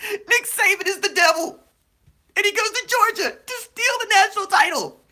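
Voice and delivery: high, loud squeal through laughter; barely getting it out while cracking up; laughing. Real, not acted. Laughing retelling of parody.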